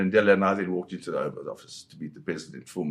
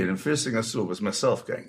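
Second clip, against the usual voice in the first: ordinary delivery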